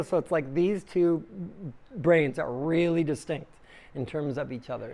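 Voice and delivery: ordinary talk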